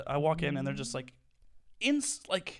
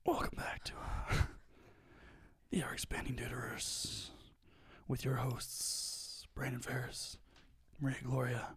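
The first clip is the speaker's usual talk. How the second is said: whispering; 5 dB quieter than usual. Acted. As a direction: hush the welcome like a secret. Whispered joke podcast intro.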